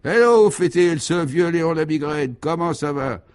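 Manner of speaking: high, loud greeting called out; hearty hail. Acted. The horse calls out to old friend Léon, a loud hearty hail. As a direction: hail an old pal from across the field.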